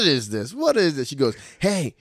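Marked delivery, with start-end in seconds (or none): 0.0-1.1 mock surprise. Mom teasing daughter's butt. 1.6-1.9 put-on higher, indignant little-girl voice. A mom voicing her daughter scolding her, high and indignant. Toddler's voice acted out.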